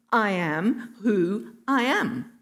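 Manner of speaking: slow, deliberate divine pronouncement; solemn declaration. Retold or imitated. God tells Moses his name, slow and solemn. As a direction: give each word room and weight. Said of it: "God tells him".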